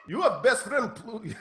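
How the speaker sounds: put-on a little Russian accent in a question; slipped Russian accent. Rival startled about Pluto, slipping into a Russian accent. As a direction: ask it with a slight Russian accent. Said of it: "got a little Russian there".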